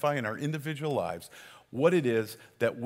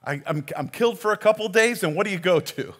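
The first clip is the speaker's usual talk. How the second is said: poking; 7 semitones higher, 4 dB louder than usual. Retold or imitated. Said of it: "Here's Jesus poking".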